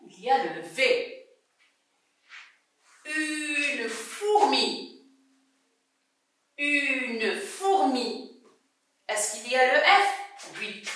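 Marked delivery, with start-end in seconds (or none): 3.1-8.4 slow, drawn-out listening model; stretched-out word. Shown as a demonstration. Teacher helps pupils listen for F, stretched-out word. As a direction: hold "Une" very long, then "fourmi". Sounding out words to hear F.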